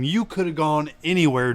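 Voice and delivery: normal talking voice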